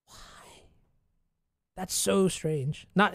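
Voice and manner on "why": unvoiced puzzled whisper; just breathe the question. Real, not acted. Puzzled at opponent's play.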